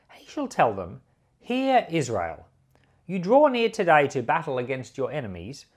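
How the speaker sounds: ordinary delivery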